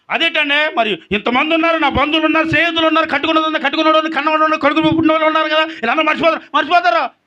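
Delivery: high, rapid-building preacher's protest; impassioned protest. Acted. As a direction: reel off the list insistently, acting the objector.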